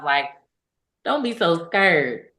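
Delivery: ordinary talk, nothing marked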